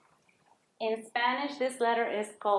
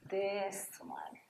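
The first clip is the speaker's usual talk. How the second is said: whisper; 2 semitones lower, 11 dB quieter than usual. Real, not acted. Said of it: "unvoiced, slow".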